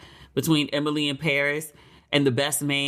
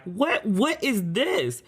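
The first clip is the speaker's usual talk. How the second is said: surprised disbelief; 5 semitones higher, much slower than usual. Retold or imitated. Reacting to exhibit pictures.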